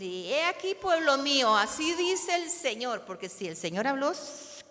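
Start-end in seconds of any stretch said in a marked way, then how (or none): 0.0-3.0 proclamar en voz alta y más aguda; profeta solemne. Shown as a demonstration. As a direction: imitate a prophet proclaiming slowly and loudly. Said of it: "no debemos de decir". (profeta que proclama solemne y fuerte)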